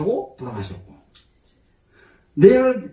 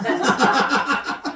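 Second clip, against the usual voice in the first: high, softer giggle at own joke; amused laugh; 5 semitones higher, 6 dB quieter than usual. Real, not acted. Preacher joking about sister's looks, amused laugh.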